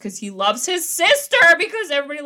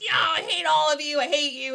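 higher-pitched mock teen outburst; emo outburst; 6 semitones higher than usual. Retelling the teen's meltdown at his mom, mock-emo outburst. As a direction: whine it like a melodramatic teenager, imitating. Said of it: "this whole little emo-tastic monologue".

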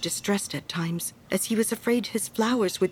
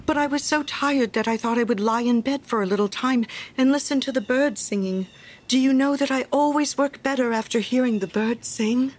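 higher, apologetic voice of a simple friend; meek little Hans; 5 semitones higher than usual. Acted. Hans excusing himself to the miller for lying in bed, meek. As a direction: explain yourself humbly in a raised pitch.

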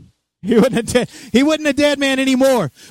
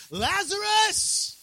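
high, drawn-out shout; loud calling shout; 11 semitones higher, 9 dB quieter, much slower than usual. Acted. Jesus calling Lazarus from the tomb, loud high shout. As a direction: call out to raise the dead. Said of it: "Jesus called in a loud voice".

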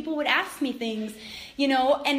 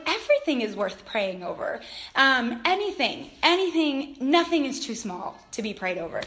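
ordinary talk

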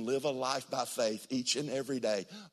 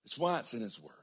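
ordinary delivery

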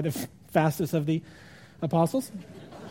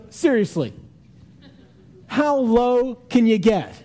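indignant disbelief; 5 semitones higher, 4 dB louder, slower than usual. Acted. Mary appalled the body was stolen, slow indignant disbelief. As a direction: drag it out in mock outrage.

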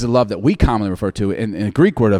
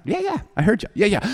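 put-on dismissive kid; 9 semitones higher, 6 dB quieter, faster than usual. Kid brushing off dad's reminder.

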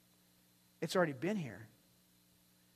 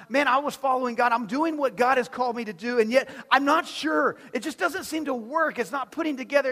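higher and loud, exasperated; frustrated believer; 7 semitones higher, 11 dB louder than usual. Retold or imitated. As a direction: vent confusion at God. Believer's confused inner voice.

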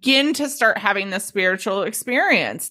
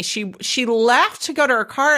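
usual speaking voice

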